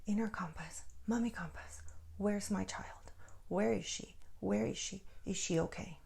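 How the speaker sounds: breathless hushed whisper to her inner compass; whispered plea. Retold or imitated. Mother whispering to her inner compass for her lost child. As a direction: whisper the questions, hand on heart. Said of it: "and I breathed, and I said".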